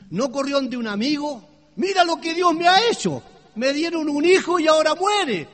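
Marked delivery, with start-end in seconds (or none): 1.8-5.5 high, loud cry of protest against God; indignant shouting. Acted. Bereaved mother railing at God for her son's death, shouting indignantly. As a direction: shout it as an outraged accusation, building louder. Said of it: "No se puso a gritar".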